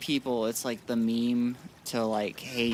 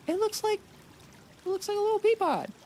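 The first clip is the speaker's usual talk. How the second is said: very high, tiny cooing voice; high-pitched marvelling; faster than usual. Real, not acted. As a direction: squeak it out, quickening at the end. Marvels at mom's bean pods.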